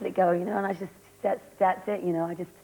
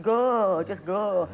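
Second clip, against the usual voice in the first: a hammed-up northern English Coronation Street accent, louder; 5 dB louder, much slower than usual. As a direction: bark it like a soap-opera northerner. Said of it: "trying to, like, really talk like that, like Coronation Street".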